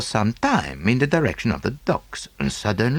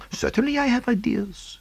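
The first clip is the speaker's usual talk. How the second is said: higher, careful Poirot voice, lightly voiced; cautious reply. A narrator's reading. Poirot answers Pearson cautiously, a light French touch. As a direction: answer warily, weighing each word. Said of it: "I replied cautiously".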